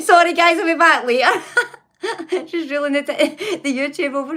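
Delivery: giggling apology, voice up high; laughing. Real, not acted. Streamer apologising to chat mid-laugh, high laughing voice.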